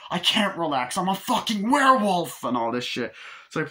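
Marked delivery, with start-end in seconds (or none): 0.1-2.4 high, loud, building to a shout; acted exasperated yell; mock frantic outburst. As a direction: imitate a man at the end of his tether. David snaps at being told relax.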